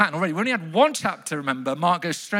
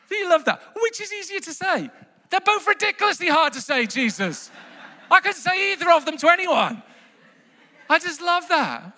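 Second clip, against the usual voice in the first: thrilled; 10 semitones higher than usual. Real, not acted. Preacher to the congregation, bursting with delight. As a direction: gush like the line just blew your mind.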